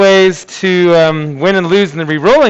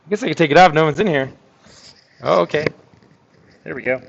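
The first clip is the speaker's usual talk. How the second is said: normal talking voice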